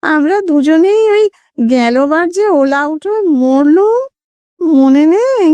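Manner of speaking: high nasal ghostly voice. A narrator's reading. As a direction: play a timid ghost speaking through the nose. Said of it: "খোনা স্বরে".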